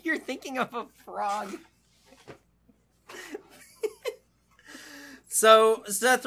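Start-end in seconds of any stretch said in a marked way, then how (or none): 0.0-1.6 laughing. Real, not acted. Repeating line while laughing.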